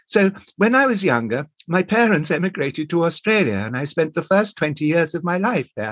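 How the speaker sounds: high, ladylike period character voice; sweet 1920s girl. Acted. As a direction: read it as the sweet English girl. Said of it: "she was talking like this".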